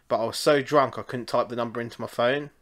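ordinary delivery

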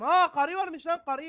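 slow, high, loud startled cry; startled shout. Real, not acted. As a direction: react loudly at a sudden discovery. Découvre une femme, surprise.

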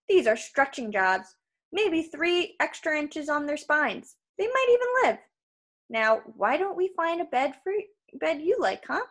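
high, sing-song salesman patter; menacing salesman, breezy. Acted. Krusty offering the boy a bed, breezy salesman. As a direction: make cruelty sound like customer service. Voices giant waterbed salesman.

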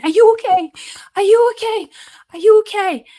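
high, loud, repeated panicked yell; retold screaming. Young driver yelling at the window after the crash, loud and high. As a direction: shout it through the car window in alarm. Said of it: "they're just screaming".

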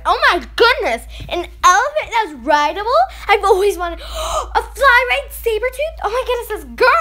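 loud, gasping astonishment; thrilled burst with a gasp; excited amazement. Real, not acted. Gamer seeing a dream trade offer, excited amazement.

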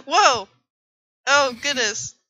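loud gasp, then whoa; surprised. Real, not acted. Sudden discovery.

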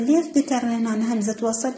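ordinary talk

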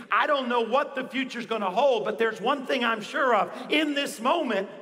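rousing, emphatic preacher's vow; determined declaration. Acted. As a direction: declare it forcefully, punching each refusal. Acts out a defiant declaration of faith.

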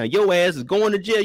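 usual speaking voice

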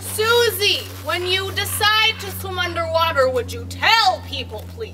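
loud scolding call. Acted. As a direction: shout a reproach across the water. Scolding child from shore.

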